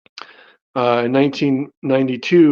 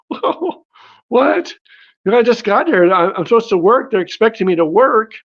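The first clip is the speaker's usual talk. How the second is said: high-pitched, startled retelling of a protest; incredulous protest; 8 semitones higher than usual. Retold or imitated. Waiter balking at angel's order to go home, incredulous protest. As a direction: act out baffled disbelief, pitched well up.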